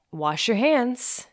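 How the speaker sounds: slow sign-off. Acted. Podcast sign-off catchphrase.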